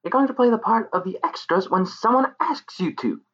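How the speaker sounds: high, bright fairy voice; sing-song threatening pixie. Acted. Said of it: "the pixie said".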